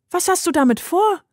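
viel höher, ängstlich; erschrockenes Mädchen. A narrator's reading. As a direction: frag entsetzt nach der Maschine. Girl alarmed at machine. (Heidi fürchtet sich vor dem Gerät, hoch)

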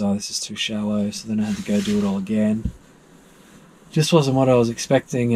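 ordinary delivery

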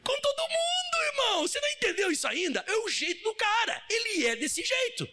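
preaching shout. Real, not acted. Pastor crying out to the church that he is like that with everyone. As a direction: cry it out to the congregation.

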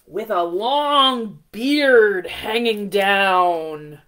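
slow, loud storybook recital; dramatic children's poem reading. Acted. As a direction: read the poem slowly to children. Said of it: "It goes".

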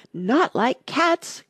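high, slow, shrill outrage; shrill passionate. A narrator's reading. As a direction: cry it out shrill and stung. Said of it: "in a shrill, passionate voice".

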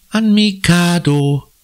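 put-on a high girl's voice, slowed; Conny's voice. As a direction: answer carefully, a little slow. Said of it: "sagt Conny".

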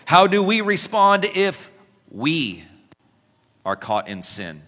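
ordinary delivery

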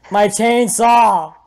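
long, high, loud wail; high drawn-out yell of dismay; anguished shout. Real, not acted. Shouting over a loss in game.

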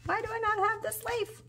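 whining to herself over a missing leaf; whiny high complaint. Real, not acted. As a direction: plead the question up high. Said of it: "I'm about to be upset".

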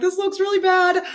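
high, dismayed inner voice; inner thought. Retold or imitated. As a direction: voice the silent panic. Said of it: "in my mind, I'm like".

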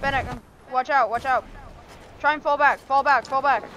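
loud urgent callouts to the team; shouting orders over battle. Real, not acted. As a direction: yell the warning, clipped and urgent. Warning teammates during a fight.

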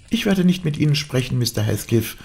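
put-on high girlish refusal; high-pitched, indignant girl; defiant young Cathy. A narrator's reading. Cathy refuses to talk to Heathcliff.

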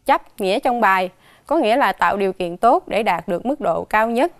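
usual speaking voice

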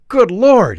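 cry out. A narrator's reading. Dickens stunned to see Father Christmas, loud cry. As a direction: burst out staggered. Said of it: "he cried out".

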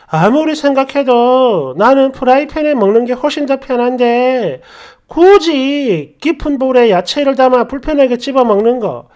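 ordinary delivery

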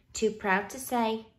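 usual speaking voice

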